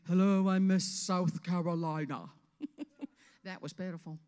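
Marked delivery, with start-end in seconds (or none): 0.1-2.3 loud, slow-swelling pageant introduction; put-on beauty queen voice, projected; generic pageant queen. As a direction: announce yourself like a generic Miss America contestant. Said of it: "be the generic image of Miss America".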